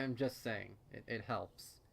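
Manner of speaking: plain reading voice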